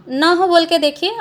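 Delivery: usual speaking voice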